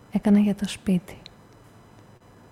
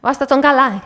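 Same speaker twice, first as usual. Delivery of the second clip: urgent order; 5 semitones higher, 6 dB louder than usual. Acted. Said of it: "είπε η κυρία Βασιωτάκη".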